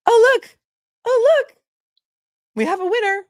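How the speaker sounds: high, loud thrilled squeal; excited squeal. Real, not acted. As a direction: squeal it out, delighted. Spotting the giveaway winner.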